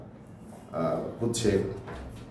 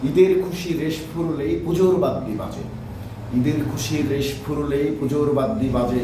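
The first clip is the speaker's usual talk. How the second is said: higher-pitched, projected rhythmic verse; rhyme recitation; 4 semitones higher, 4 dB louder than usual. Acted. Poet recites own Eid rhyme from memory, lifted declamation. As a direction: declaim it from memory, lifting each opening phrase.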